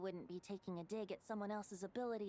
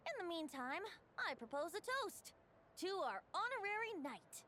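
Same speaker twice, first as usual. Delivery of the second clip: proposing a toast; 8 semitones higher than usual. Acted. Venti raises a toast.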